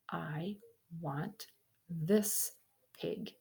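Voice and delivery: soft, slow, deliberate "I want this pig"; quiet and cold, each word placed. Acted. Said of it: "said in a very quiet voice".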